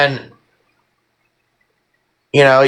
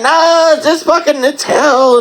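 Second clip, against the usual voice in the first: despairing; 9 semitones higher than usual. Retold or imitated. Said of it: "and like".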